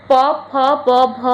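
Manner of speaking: ପ, ଫ, ବ, ଭ sounded out one by one from the lips; pronunciation demonstration. Shown as a demonstration. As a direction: say ପ, ଫ, ବ, ଭ as separate, distinct lip sounds. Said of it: "ଉଚ୍ଚାରଣ କରି ଦେଖନ୍ତୁ". (ପ, ଫ, ବ, ଭ ଅଲଗା ଅଲଗା କରି ସ୍ପଷ୍ଟ ଉଚ୍ଚାରଣ)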